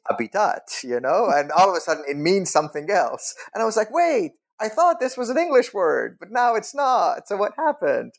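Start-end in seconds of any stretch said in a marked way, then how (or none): 0.1-0.6 habitat said the French way, ah-bee-TAHT; a light French habitat, stress on the end; mock French accent. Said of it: "they said them with a French accent". 3.9-8.1 high, bewildered questioning; lost and puzzled; baffled confusion. Retold or imitated. Confused student inner voice.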